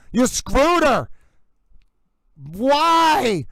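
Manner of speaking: normal talking voice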